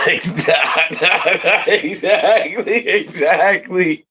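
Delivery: high, laughing and drawn out. Real, not acted. Friend laughing along at the bit, high laughing. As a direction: laugh through the words, agreeing.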